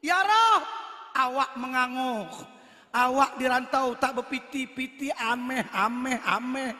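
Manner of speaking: put-on loud Minang-accented prayer. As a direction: cry out like a Padang man. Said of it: "Padang baca doa".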